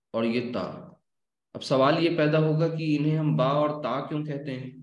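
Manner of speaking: ordinary talk, nothing marked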